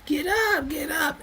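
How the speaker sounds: high, soft falsetto urging; imitating her pleading. A woman urging a staggering man to stand, in high falsetto. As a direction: coax him up in a thin high voice. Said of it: "she kept calling his name".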